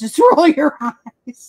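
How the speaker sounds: amused, drawn-out retold reaction; laughing dismissal. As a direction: draw it out loudly with a laugh.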